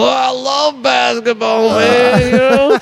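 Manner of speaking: a lightly voiced enthusiastic Bob; imitating Bob. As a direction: Bob keenly saying he loves basketball. Bob answering about basketball.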